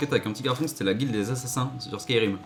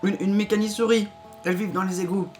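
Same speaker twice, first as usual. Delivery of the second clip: put-on high, timid child; squeaky little boy; 8 semitones higher than usual. Child Frito voice. (voix de petit garçon)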